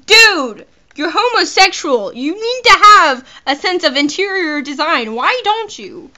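loud, high, swooping game character; hammed-up high character voice. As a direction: exclaim it in a high, exaggerated voice. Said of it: "With the voice you gave him".

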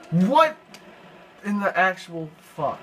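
a sudden high, loud "What"; shocked outburst. Real, not acted. Gamer yelling at the game in shock. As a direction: blurt it out in disbelief.

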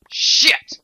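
explosive, loud cartoon curse; cartoonish yelp. Player swearing in surprise, cartoonish yelp. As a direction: burst it out like a cartoon. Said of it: "sounded like such a cartoon".

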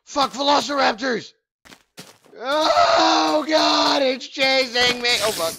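panicked screaming; terrified yelling while being chased; scared horror game streamer. Real, not acted.

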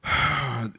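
long soft audible sigh; demonstration sigh. Shown as a demonstration. Pastor showing the congregation how to sigh, a slow sigh. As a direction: let out a slow deep sigh. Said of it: "when you sigh".

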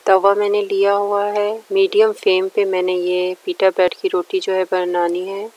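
normal talking voice

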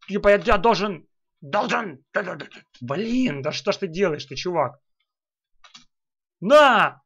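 usual speaking voice

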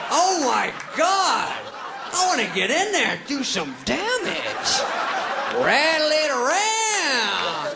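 high, loud, hammed-up old-lady holler; mock grandma voice, stretching words out as it builds; lustful raving grandma. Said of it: "my grandma".

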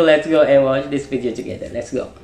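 normal talking voice

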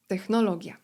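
ordinary talk

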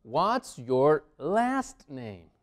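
very slow, each word separate; drawn-out, deliberate model question; slow teaching model. Shown as a demonstration. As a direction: space out the words for learners. Teacher models new question slowly.

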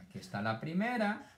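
ordinary talk

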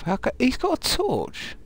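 high, drawn-out squeal of disbelief; incredulous outburst. Real, not acted. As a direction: blurt it out high and loud, outraged. Startled by zombie carrying a torch.

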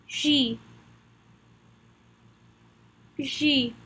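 J said as a soft "zhee"; French letter name. Shown as a demonstration. Teacher leads repetition of the letter, J said as French "zhee". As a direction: model J slowly as French "zhee", falling. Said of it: "Practice saying it with me".

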